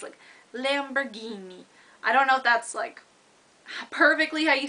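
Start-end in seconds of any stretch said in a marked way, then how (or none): 0.5-1.6 Lamborghini rolled out with an Italian accent, every syllable savoured. Shown as a demonstration. Vlogger showing off her Italian word in Italian accent. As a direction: say Lamborghini the Italian way, playful and drawn out. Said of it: "well, with an Italian accent".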